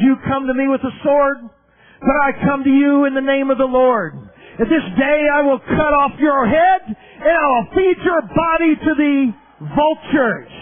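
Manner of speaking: put-on a shepherd boy shouting down Goliath; bold declaration. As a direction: hurl the prophecy at the giant. David's prophetic declaration, shouted.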